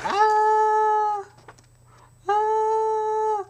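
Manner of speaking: hammed-up scream of pain; mock scream. Man tortured at the table, screaming. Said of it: "is screaming".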